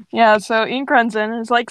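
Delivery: plain reading voice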